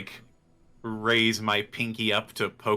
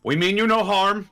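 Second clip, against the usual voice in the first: raised peaceful call; 8 semitones higher, 6 dB louder than usual. Acted. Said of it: "say as I raise my hands".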